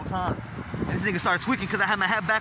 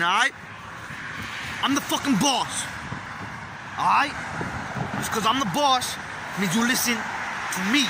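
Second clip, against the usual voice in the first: hyped boss rant; 4 semitones higher than usual. Acted. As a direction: hype yourself up as the boss.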